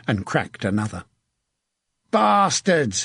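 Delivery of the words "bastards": high, falling cry of abuse; shouted curse. A narrator's reading. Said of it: "he kept shouting".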